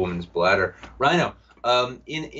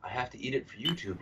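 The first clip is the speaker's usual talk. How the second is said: quick, much softer mock excuse; meek husband reply; 11 dB quieter, faster than usual. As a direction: answer the wife quickly, under your voice. Acted husband answering the nagging wife.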